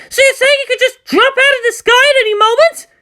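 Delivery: high, loud, half laughing, half crying; near-hysterical disbelief. Acted. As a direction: lose control for a moment. Frightened commander, laughing-crying.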